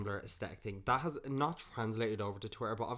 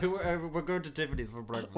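mock South African accent; 5 semitones higher, 5 dB louder than usual. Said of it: "she was from South Africa".

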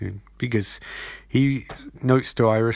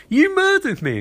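mock Sisko accusation. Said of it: "Sisko just laughs and goes".

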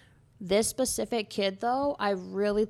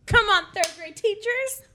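much higher and louder playful appeal; joking call-out; 11 semitones higher, 7 dB louder than usual. Real, not acted. Blanking on grammar, calling to teachers, playful shout.

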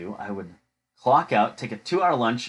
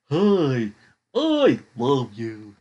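slow, drawn-out talking toy; Barney; 4 dB louder, much slower than usual. Retold or imitated. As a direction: imitate a talking storybook toy, very slowly. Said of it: "it's Barney and stuff like that".

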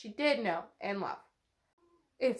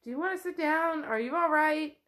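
quick, higher, worried offer; anxious asking; 5 semitones higher than usual. Retold or imitated. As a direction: rush the question at her. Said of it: "someone's like".

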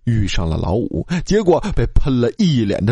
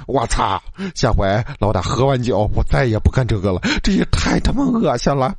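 ordinary delivery

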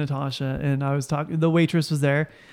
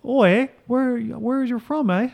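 lilting, higher mock Canadian accent; 7 semitones higher, slower than usual. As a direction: put on a playful Canadian "eh". Playfully acting a Canadian.

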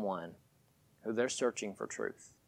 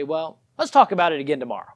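coworker brushing off; 6 semitones higher, 11 dB louder, faster than usual. Retold or imitated.

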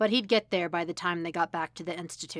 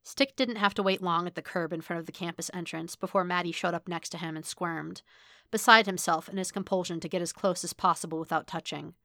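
ordinary delivery, nothing marked

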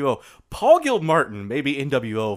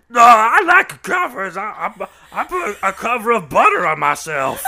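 Cornbread voice; 8 semitones higher, 7 dB louder than usual. Pretend. Mimicking the dog Cornbread for her co-host, high and loud. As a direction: play it dopey and proud, almost shouting. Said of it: "Cornbread will be like".

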